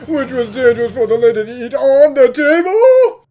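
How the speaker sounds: alarmed. Acted. Detective alarmed at peanut butter.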